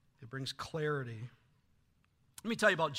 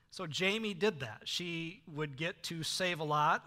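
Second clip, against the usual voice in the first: normal talking voice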